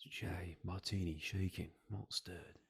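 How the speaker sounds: slow, hushed secret-agent voice; James Bond. Acted. Friend redoing the movie quote game line as James Bond, slow whisper. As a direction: whisper it slowly, drawing out every word. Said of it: "you see James Bond getting it".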